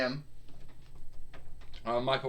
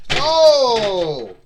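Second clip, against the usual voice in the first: loud higher hammed-up grunt; pained wrestler yell; 7 semitones higher, 14 dB louder than usual. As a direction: take the hit with a big yell. Stunner hits.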